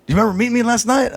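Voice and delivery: a chipper guy introducing himself quickly; Alex on the phone. Retold or imitated. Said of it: "He's like".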